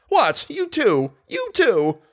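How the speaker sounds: high, shocked exclamations; startled. A narrator's reading. Manager hears groom blame the ghost, startled. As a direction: jump in with astonished disbelief.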